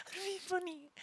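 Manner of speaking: high, breathless laughing words. Real, not acted.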